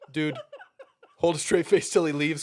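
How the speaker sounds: trying not to laugh; words breaking into laughter. Real, not acted.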